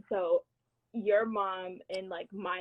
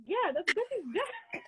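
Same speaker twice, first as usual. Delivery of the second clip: laughing confirmation; 12 semitones higher, slower than usual. Retold or imitated. Retelling cousin's amused confirmation.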